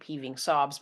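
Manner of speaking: ordinary delivery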